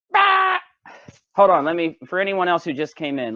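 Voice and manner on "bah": high blurted "bah" noise; song-title bleat. Pretend. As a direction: blurt a silly, high animal-like cry. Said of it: "The name of the song that you just heard was".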